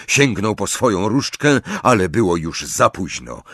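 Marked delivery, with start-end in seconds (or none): none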